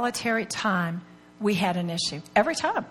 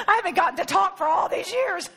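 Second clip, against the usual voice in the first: high-pitched, through laughter; giggly mock complaint, pitched up; laughing; 8 semitones higher than usual. Real, not acted. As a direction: joke while cracking up. Joking between bursts of laughter.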